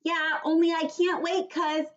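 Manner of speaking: put-on high, loud little girl yelling for help; Junie B. yelling. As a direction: cry for help like a panicked kid. Junie B. interrupting Mother.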